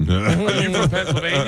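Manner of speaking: high-pitched, incredulous questioning; mock accusation. Colleague catching Kyle out on his hometown, mock accusing. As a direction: pounce on him with the question. Leanne confronts Kyle in retelling.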